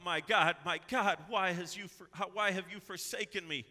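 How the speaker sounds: loud, high cry of despair; preacher acting Jesus crying out, loud and high; anguished cry. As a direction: wail the question upward. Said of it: "when he cries out".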